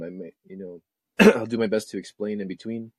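throat-clearing, catchy voice; something stuck in the throat; frog in throat. Real, not acted. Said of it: "Sorry, I've got something in my throat here".

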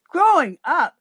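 high-pitched, amused delivery; lightly acted laughing incredulity; laughingly. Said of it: "she laughs".

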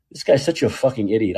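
ordinary delivery

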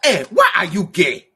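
loud, insistent mock African accent; generic African accent. Copying an African interviewer, loud African accent. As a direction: demand it in a put-on African accent. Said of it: "generic African".

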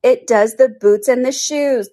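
slow, high, loud pronouncement; solemn. A narrator's reading. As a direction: announce it slowly with mock gravity. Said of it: "the Griffin replied solemnly".

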